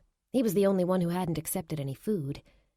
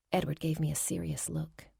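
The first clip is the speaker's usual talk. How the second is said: ordinary delivery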